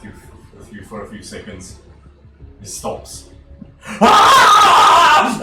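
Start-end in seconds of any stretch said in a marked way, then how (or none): 3.8-5.4 high screaming panic with laughter; panicked shriek. Acted. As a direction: shriek in terror, laughing through it. GM voices panicked Farazan.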